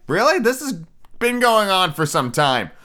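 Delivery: high-pitched, incredulous viewer's complaint; mock disbelief. Viewer reacting to an overlong musical number, mock incredulous.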